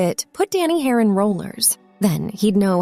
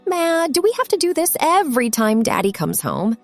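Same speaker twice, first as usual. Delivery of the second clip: put-on higher-pitched kid's complaint; put-upon, higher-voiced protest; complaining; 6 semitones higher than usual. A narrator's reading. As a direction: complain to your mother about the chore. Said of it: "All she did was complain".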